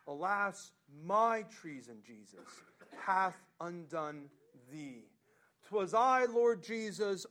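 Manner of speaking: loud, swelling recitation of the hymn; fervent hymn reading at full voice; hymn read aloud. Acted. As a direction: proclaim each line of the verse. Reads hymn stanzas with force.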